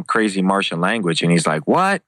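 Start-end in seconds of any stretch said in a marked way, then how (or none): 1.7-2.0 sharp, much higher rising What?; mock disbelief, pitch leaps up; imitating a shocked fighter; stunned what. Nick's stunned reply, retold story.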